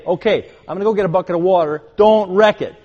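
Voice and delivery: high, loud grandfatherly warning, building; stern loud warning. Acted. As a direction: call out a firm warning to the grandchildren. Grandfather warning children at beach.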